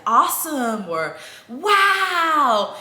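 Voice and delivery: high loud "Wow"; new praise words. Shown as a demonstration. Mom's new varied praise for toddler, high loud cheer. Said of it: "now I'm saying".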